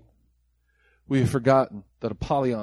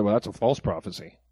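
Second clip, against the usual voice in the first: lower, softer dismissive churchgoer voice; mocking skeptic; 6 semitones lower, 4 dB quieter than usual. Preacher mocking American churchgoers who doubt prophecy, low and soft. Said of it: "American church were there".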